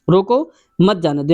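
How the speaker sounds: "रोको" on its own, then "मत जाने दो"; pause after रोको. Shown as a demonstration. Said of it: "के बाद लगा दें".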